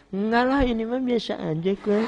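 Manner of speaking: high-pitched mock wife brushing off thanks; coy mother. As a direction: play up the bashful "it's nothing". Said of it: "Apa kata ibunya?". (ibu tersipu yang dilebih-lebihkan)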